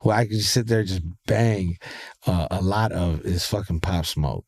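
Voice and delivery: normal talking voice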